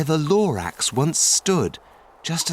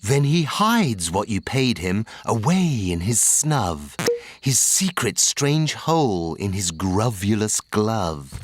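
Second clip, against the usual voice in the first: ordinary delivery, nothing marked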